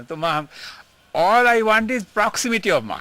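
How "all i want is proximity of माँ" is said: emphatic longing. Real, not acted. Pleading his longing for mother.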